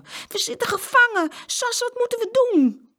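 very high, loud panicky cry; panicked. A narrator's reading. Boy trapped in the car to his sister, panicked. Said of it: "In paniek".

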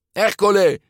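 loud, high shouted name; shouting. A narrator's reading. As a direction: shout a warning across the water. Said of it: "schreeuwde ze". (luid geschreeuwd)

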